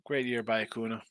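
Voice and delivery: ordinary delivery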